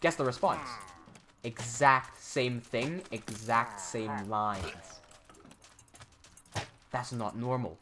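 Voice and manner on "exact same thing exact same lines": very slow, weighted words; slow, pointed repetition; slow emphatic. Real, not acted. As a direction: drag each word out for emphasis. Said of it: "emphasising sameness".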